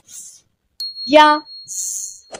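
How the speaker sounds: या, स stretched very slowly; teacher sounding out letters; very slow drawn out. Shown as a demonstration. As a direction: draw each sound out long. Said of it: "अब इनको एक साथ पढ़ते हैं".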